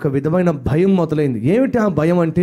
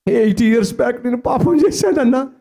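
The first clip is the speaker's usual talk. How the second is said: put-on pleading young man; 12 semitones higher than usual. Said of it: "అంటే".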